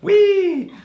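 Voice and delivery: high, loud squeal of "whee"; mock-giddy joyride cheer; playful whee. Giddy joke about drinking sake.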